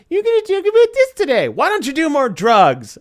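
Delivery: mock the speaker like a heckler. Unmuted stranger heckling the webinar host, mocking voice. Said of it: "somebody that wasn't muted goes".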